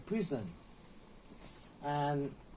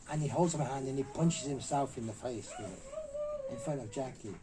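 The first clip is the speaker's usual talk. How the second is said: ordinary talk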